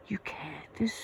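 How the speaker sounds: hushed, broken-off whisper; whispering in disbelief at a coworker; stunned whisper. Retold or imitated. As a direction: choke on the words in a shocked whisper. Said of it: "And I'm like".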